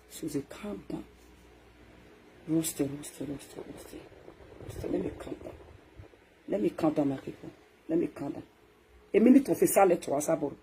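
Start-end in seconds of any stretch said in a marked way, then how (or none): 2.5-4.9 much softer fading roasting; muttered. Acted. Seething to himself, soft muttering. 4.9-8.4 whispered let me calm down; quiet self-calming. Acted. Calming himself before the crowd, whispered. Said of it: "I cool my temper".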